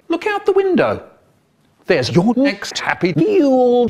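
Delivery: dad's high-pitched jeer; mock taunt. Dad taunts the Queen.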